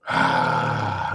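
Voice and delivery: long, low exasperated sigh; heavy sigh. Real, not acted. Host pausing over the money sent overseas, heavy sigh. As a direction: let out a long weary breath. Exasperated break while reading the news.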